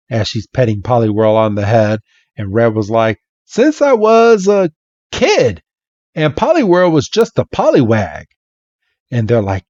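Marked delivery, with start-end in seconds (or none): 3.4-8.2 put-on high boyish Red. Red answering fans.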